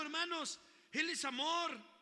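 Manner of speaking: loud, high, fervent preaching; impassioned pulpit call, ringing out; preaching at full force. Acted. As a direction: drive each line home to the congregation. Preaching to congregation.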